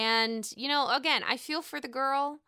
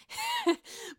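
high burst of laughter; laughing at a cute moment. Real, not acted. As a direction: break into a giggle. Laughing at the scene.

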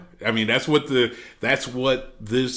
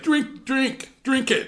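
high-pitched, mock pushy host; lightly acted high-voiced nagging; imitated insistent urging; slower than usual. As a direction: urge a guest on in a raised pitch. Retold host pushing a drink.

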